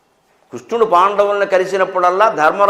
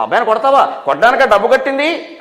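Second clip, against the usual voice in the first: higher, rising mock protest; indignant parent; 5 semitones higher than usual. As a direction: imitate an outraged parent challenging the teacher. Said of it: "అని, డబ్బు కట్టింది ఎందుకని అడుగుతారు".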